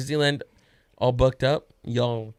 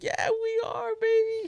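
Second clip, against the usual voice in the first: put-on high, softer baby-talk reply; retold doting dad; 3 dB quieter than usual. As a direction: answer a thrilled little kid indulgently. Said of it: "And I was like".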